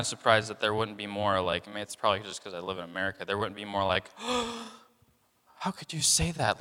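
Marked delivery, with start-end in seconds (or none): 4.2-4.7 sharp high gasp of mock offense; shocked gasp. 5.6-6.6 offended. Pretend. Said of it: "Like, offense".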